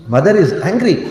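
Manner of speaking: usual speaking voice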